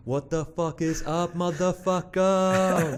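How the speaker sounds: high, slow drawn-out yelling greeting; stretched-out mock shout; hammed-up yell. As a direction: bellow it like a rowdy mate. Prank greeting to mate.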